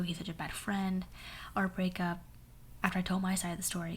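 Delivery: usual speaking voice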